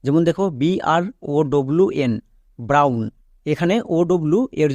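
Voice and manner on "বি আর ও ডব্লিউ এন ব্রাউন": usual speaking voice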